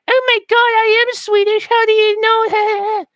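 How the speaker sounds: high, gushing falsetto; flattered woman. Acted. Woman surprised he guessed Swedish, high mock falsetto. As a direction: gush like a mock woman, drawing it out.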